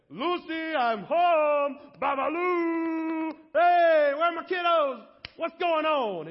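loud, high, booming hello to the family; big hammed-up Ricky-style greeting; cheerful shouted homecoming. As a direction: burst through the door yelling happily for wife and kids. Husband bursting home cheerfully.

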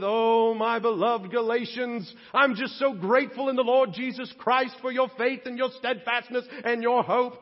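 Paul's greeting as the Galatians might have wanted it, overly sweet and gushing; mock gushing greeting. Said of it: "It doesn't start with".

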